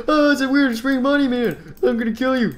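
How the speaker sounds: high-pitched excited toy voice; squealing car voice. Acted. Car threatening weird bunny man, high squeal.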